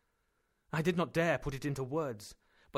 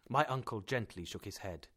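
ordinary delivery, nothing marked

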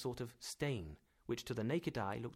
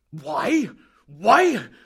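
loud; 11 semitones higher, 19 dB louder than usual. Acted. Professor brushes off question.

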